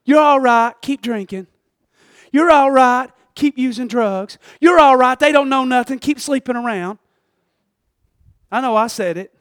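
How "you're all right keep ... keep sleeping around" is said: higher, breezy mock reassurance; mock the easy yes-men; lightly voiced flatterers; enabling friends. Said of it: "people in your life saying".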